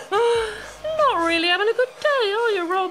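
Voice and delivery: high, giggling mock pity; amused mock concern; laughing fake sympathy. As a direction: laugh through the false sympathy.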